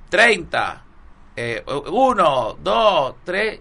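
loud counting of reps; coach counting. Retold or imitated. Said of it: "y vamos con el abdominal".

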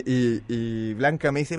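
ordinary talk, nothing marked

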